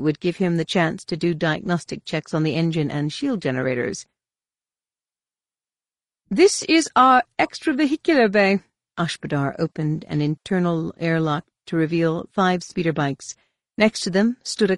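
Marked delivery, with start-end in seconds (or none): 6.3-8.6 high, cool alien administrator; alien official voice. Acted. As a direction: speak as a precise alien official.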